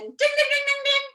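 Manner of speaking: very high ding-ding chant; alarm bell ringing. Acted. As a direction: ring like an alarm clock. Alarm goes off again.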